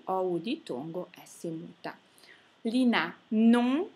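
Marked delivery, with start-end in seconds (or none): 3.3-3.9 "non" loud and higher, vowel through the nose; nasal vowel. Shown as a demonstration. Teacher demonstrates the nasal, loud nasal vowel. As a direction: say "non" firmly with no closing N.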